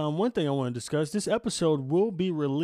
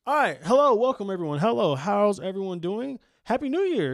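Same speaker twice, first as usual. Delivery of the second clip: bright, high, loud podcast hello; hyped greeting; 8 semitones higher, 8 dB louder than usual. Real, not acted. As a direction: greet the listeners full of energy.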